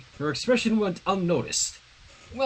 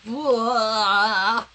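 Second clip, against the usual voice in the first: dumbstruck blah; 4 semitones higher, 5 dB louder than usual. Acted. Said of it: "Sunset managed to say".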